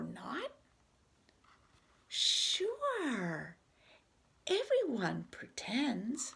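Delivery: expressive read-aloud with swooping pitch; animated picture-book dialogue. Acted. Reader voices two friends arguing over pretending, animated. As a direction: act out both characters for young children.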